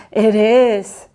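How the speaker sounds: proudly. Acted. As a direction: puff up with pride. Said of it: "said Winston proudly".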